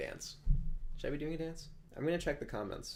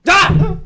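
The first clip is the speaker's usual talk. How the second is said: sudden high loud scream; jump scare scream; 27 dB louder than usual. Pretend. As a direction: shriek as if jumping out to scare. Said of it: "Scare me".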